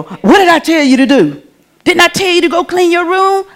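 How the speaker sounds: lightly acted stern parent, pitch climbing; scolding parent. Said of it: "what you wanna do, you wanna break that child's will".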